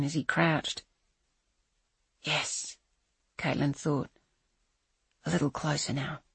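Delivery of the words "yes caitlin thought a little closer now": hushed inner thought. A narrator's reading. Silent thought as killer approaches.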